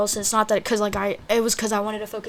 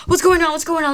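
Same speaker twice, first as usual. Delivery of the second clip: retold urgent, higher-pitched questioning; panicked; 8 semitones higher, 5 dB louder than usual. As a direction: reenact demanding answers in a panic. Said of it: "my heart's racing".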